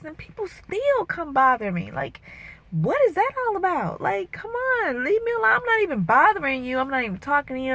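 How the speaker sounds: high, exasperated complaint. Real, not acted. Woman venting about people who bother her, exasperated and high. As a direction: plead to be left alone, fed up.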